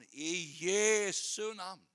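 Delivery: very slow, stretched healing prayer; drawn-out prayer. Retold or imitated. Healer prays over a boy who cannot walk, drawn-out prayer. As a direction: stretch each word out solemnly as a prayer.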